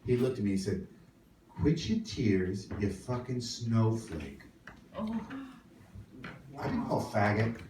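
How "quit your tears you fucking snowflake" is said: low, soft, slow contempt; cold put-down. Retold or imitated. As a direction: deliver the insult slowly and quietly. Man coldly delivers the decisive insult.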